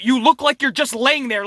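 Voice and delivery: usual speaking voice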